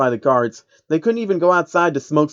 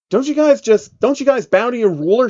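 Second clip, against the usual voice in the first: high, affronted pony asking; indignant pony; 9 semitones higher, 4 dB louder than usual. Acted. Librarian pony scolding the humans, high. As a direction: scold them for not bowing. Pony demands they bow.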